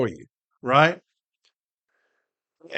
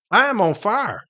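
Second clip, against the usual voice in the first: raised, heated declaration of rage; enraged; 7 semitones higher, 5 dB louder than usual. Real, not acted. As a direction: say it hot and high, fired up. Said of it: "I'm enraged".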